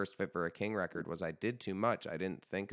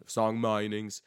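exaggerated Australian twang, a bit louder; terrible Australian accent; 3 semitones higher, 6 dB louder, slower than usual. Podcast host slips into song-meanings joke, bad Australian accent. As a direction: put on a bad Aussie voice. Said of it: "that's my terrible, uh, Australian accent".

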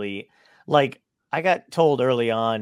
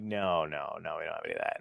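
low, soft mock staffer brushing it off; lightly voiced shrug of a no; understated offhand no; offhand dismissal; 8 semitones lower, 8 dB quieter than usual. Staff dismissing request for mic.

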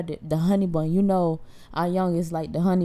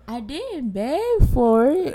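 put-on slow, higher whining child; whiny kid voice; 7 semitones higher, 5 dB louder, slower than usual. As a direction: lightly mimic a sulky kid. Mom imitating her son.

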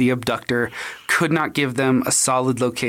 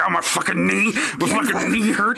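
mock pissed Ken; 4 semitones higher than usual. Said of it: "He's like".

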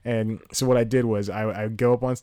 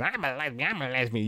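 softer, quick repetitive mock chant; mimicked heckled woman; 4 semitones higher, 4 dB quieter than usual. Comic retelling a woman's comeback to a heckler, softly mimicked repetition. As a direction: imitate her, quietly looping the same phrase. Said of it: "just kept going over and over again".

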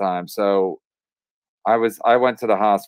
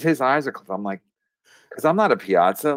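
usual speaking voice

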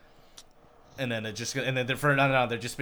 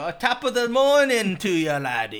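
high, loud stage-Irish greeting; mock Irish brogue; 9 semitones higher, 9 dB louder than usual. Friends mocking movie accents, hammed-up Irish brogue. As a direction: ham up a leprechaun's morning greeting. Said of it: "they go".

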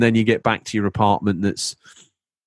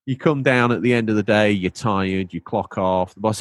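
normal talking voice

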